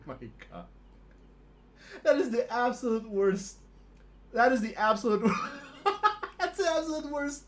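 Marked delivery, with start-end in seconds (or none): 2.0-7.4 laughing through words. Real, not acted. Laughing at terrible roll.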